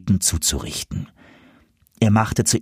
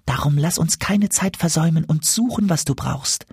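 much higher, kindly goose voice; goose Mimi; 8 semitones higher than usual. A narrator's reading. Goose Mimi calms the despairing dwarf, high and kind. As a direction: reassure him, she knows the herbs.

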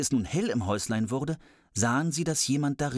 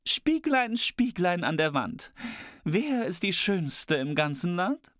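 high, slow queenly chant; queen's incantation; 7 semitones higher, slower than usual. Acted. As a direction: intone to the mirror as queen. Queen asks the mirror.

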